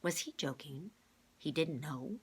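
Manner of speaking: plain reading voice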